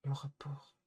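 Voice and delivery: a muttered whispered repeat. Real, not acted. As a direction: whisper "Le rapport" to himself.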